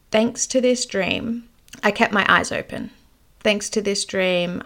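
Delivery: normal talking voice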